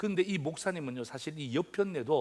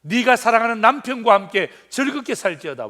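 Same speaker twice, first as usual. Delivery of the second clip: louder and higher, proclaiming the verse; emphatic proclamation; 6 semitones higher, 12 dB louder than usual. Acted. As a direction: announce it to the whole church. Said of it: "preacher proclaims verse". (힘차게 말씀 선포)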